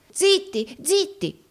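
very high-pitched, frightened hushing; squeaky, scared old schemer; terrified. A narrator's reading. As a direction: hush them in a panic. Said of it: "esclamò Gride atterrito".

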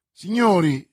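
loud, commanding address; calling to order. A narrator's reading. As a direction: call the duellists to attention. Duellist addresses both sides before combat.

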